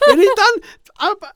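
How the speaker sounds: ordinary talk, nothing marked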